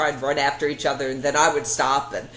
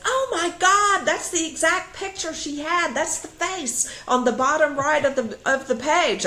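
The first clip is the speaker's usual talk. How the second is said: amazed neighbour; 12 semitones higher than usual. Retold or imitated. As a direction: gush in amazed excitement. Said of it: "woman next to me goes".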